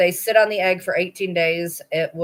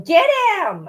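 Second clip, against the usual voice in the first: high excited get him; husband urging; 12 semitones higher, 4 dB louder than usual. Retold or imitated. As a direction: egg her on to grab the puppy. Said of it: "my husband is over there going".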